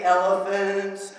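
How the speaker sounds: low, very drawn-out words; heavy, slow, low chant; elephant-like. Acted. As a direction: lumber slowly through each word. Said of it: "move like this".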